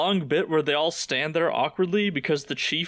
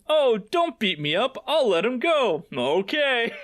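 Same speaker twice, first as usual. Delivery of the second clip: mock cowering; 8 semitones higher than usual.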